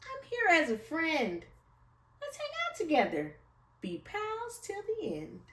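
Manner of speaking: high small voice offering friendship; peeping mouse. Acted. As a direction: chirp it kindly to the lion. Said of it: "Mouse peeped".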